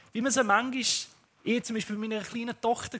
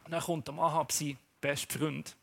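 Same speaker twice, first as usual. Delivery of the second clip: normal talking voice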